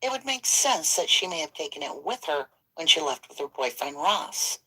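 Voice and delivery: quiet, measured true crime read; scripted narration. Acted. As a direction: read the case notes evenly. Reads prepared case script.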